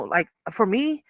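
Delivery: ordinary talk, nothing marked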